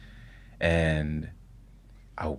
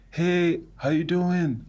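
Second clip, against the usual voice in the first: grandfather voice; 10 semitones higher, much slower than usual. Retold or imitated.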